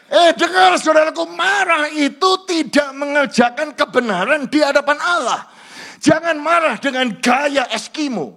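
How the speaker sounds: loud, high fiery sermon voice; preaching at full force. Acted. Pastor urging husbands and wives, fiery preaching. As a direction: thunder the warning at the congregation.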